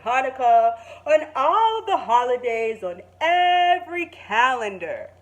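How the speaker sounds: slow, loud, grand principal voice; Mister King announcing. Acted. Principal Mister King addresses all the students, slow and grand. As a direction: proclaim it proudly and slowly. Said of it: "called everybody together and said".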